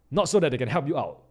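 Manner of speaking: ordinary delivery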